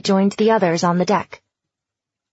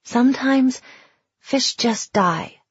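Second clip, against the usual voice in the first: gentle; 4 semitones higher, slower than usual. Acted. Comforting a sad child.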